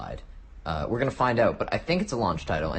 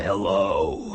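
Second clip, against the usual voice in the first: mock gruff soldier.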